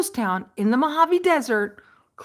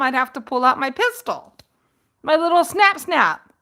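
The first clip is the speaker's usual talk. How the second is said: ordinary delivery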